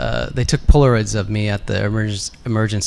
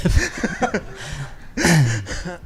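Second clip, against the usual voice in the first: light, higher laugh; laughter; 4 semitones higher, 4 dB quieter than usual. Real, not acted. Guest repeats the toe joke, laughter. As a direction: laugh at your own callback.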